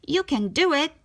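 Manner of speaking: lightly voiced Scuttle. Scuttle the seagull cheering Ariel as she stands, lightly voiced. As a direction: cheer her on like an eager bird.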